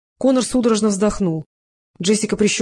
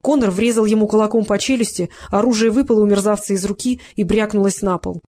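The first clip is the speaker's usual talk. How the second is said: plain reading voice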